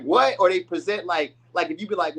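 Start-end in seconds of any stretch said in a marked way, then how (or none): 0.0-0.4 higher, louder startled "What?"; mock surprised what. Reader voicing a partner caught off guard, mock startled. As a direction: feign shock, snapping the word up.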